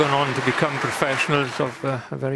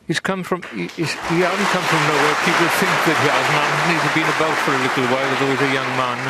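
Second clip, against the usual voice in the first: raised, louder insistence; emphatic insisting; 3 semitones higher, 5 dB louder than usual. Real, not acted. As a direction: push the point hard, rising up. Commentator insists, voice rising.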